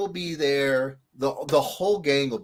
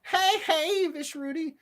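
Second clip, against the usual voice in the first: cheery greeting; 14 semitones higher, slower than usual. Real, not acted. Greeting a chat viewer.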